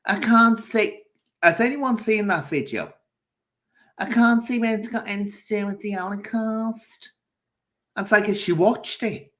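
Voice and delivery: high, worked-up disbelief; exasperated retort. Real, not acted. Fed up with Carrie missing the point, exasperated. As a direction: pitch it high, incredulous at being misread.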